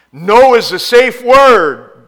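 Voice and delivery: high, loud and slowed right down; a preacher's drawn-out loud emphasis; slow emphatic stress. Acted. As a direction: lean hard on every word, slowly. Preacher stresses a key line.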